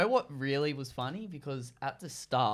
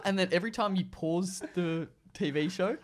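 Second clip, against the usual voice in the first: ordinary talk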